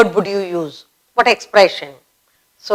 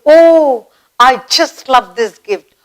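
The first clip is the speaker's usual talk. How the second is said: delighted surprise; 3 semitones higher, slower than usual. Shown as a demonstration. Teacher demonstrating joy at a gift. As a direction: gush over the gift. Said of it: "you say".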